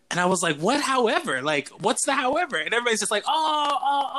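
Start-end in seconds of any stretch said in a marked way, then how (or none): 0.6-2.6 pitched-up mock disbelief at the verdict; retold disbelief. Retelling his shock at the counselor's however, pitched-up disbelief. 3.3-4.1 high, sinking oh, oh; mock kids' dismay. Campers realizing their mistake, high mock dismay. Kids react to the bad news.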